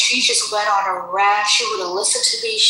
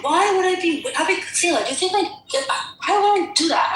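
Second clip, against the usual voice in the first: high, incredulous protest; retold exasperation, pitched way up; exasperated disbelief; 7 semitones higher than usual. Retelling heated argument with coworker.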